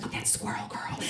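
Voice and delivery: hushed hallway whisper; imitating whispered gossip; gossipy whisper. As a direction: whisper it behind someone's back. Hallway gossip about her.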